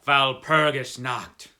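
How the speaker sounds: loud, slow "Walpurgis Nacht" with German v and ch; a hushed dread pushed out loud and slow; fearful warning. Acted. Frightened coachman crossing himself, loud and slow. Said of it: "saying as he crossed himself".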